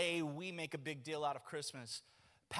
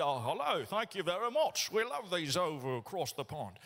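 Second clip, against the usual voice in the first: hammed-up posh British accent, a bit louder; fake British accent; 5 dB louder than usual. Pastor mimicking a British shopper for free chicken, mock British accent. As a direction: put on a jokey British accent to grab more samples. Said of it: "using fake accents".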